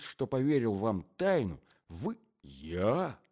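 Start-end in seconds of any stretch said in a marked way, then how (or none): none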